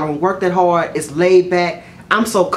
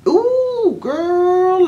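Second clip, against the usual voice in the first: gossipy exclamation; 11 semitones higher than usual. Real, not acted. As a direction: sing out scandalized disbelief up high. Gossiping about a yelling customer.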